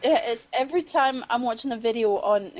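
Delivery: ordinary talk, nothing marked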